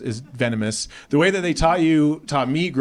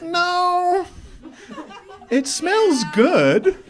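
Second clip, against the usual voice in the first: very high, drawn-out protest; stretched, squeaky mock horror; dismayed denial; much slower than usual. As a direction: whine it up high in comic disbelief. Acted disbelief at server's answer.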